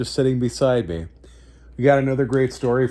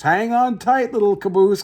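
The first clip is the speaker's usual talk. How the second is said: higher calling voice to the caboose; 8 semitones higher than usual. Acted. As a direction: call out a warning up the train. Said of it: "called the flat car".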